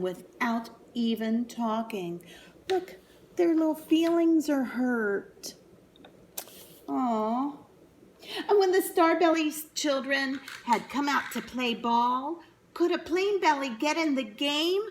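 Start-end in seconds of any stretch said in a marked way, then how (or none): none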